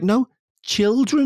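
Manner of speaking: put-on high, slow correcting "children"; imitated correction. Becca correcting her pronunciation, imitated slow "children". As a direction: correct it high and slowly, spelling out "children" by sound. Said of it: "she's like".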